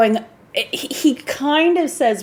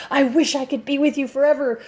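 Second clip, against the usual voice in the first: higher-pitched, hammed-up romantic declaration; mock passionate lover; 4 semitones higher than usual. Movie lover gazing into her eyes, mock passionate. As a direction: parody a movie heartthrob's yearning. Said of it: "looks deeply and passionately into her eyes".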